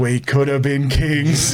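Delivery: slow, stretched-out punchline; drawn-out regret. Retold or imitated. Storyteller repeating cousin's line for laughs, drawn-out regret. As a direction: draw out each word, ending on a lingering KINGS.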